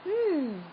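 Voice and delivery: high, pondering hum; thoughtful hmm. A narrator's reading. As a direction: weigh the idea. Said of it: "thought Sam".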